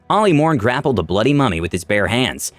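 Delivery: ordinary delivery, nothing marked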